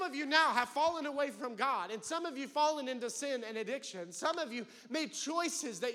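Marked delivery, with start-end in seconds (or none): none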